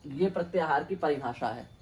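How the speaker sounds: measured, chanted Sanskrit definition; teacher intones the Sanskrit definition; Sanskrit recitation. Acted.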